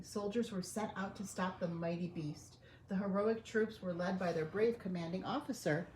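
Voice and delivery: ordinary delivery, nothing marked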